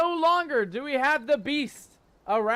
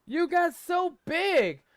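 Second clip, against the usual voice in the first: higher mother's voice, moved and fussing; doting mother; 5 semitones higher than usual. Acted. Mother Talon reuniting with her grown son, higher and doting. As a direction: gush over him like a proud mum.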